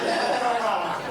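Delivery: big loud burst of laughter; a hearty cackle of laughter. Real, not acted. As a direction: laugh hard at the punchline. Laughing at own punchline.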